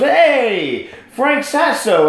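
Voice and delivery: imitated hailing call. Councilman calls out across room.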